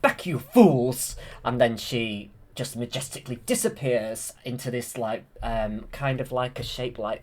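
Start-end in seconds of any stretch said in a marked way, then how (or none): 0.0-1.2 loud, drawn-out villainess command; Maleficent. Retold or imitated. Maleficent warding off the King's men, loud mock command. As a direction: throw it out loud and slow. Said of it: "and then Maleficent's like".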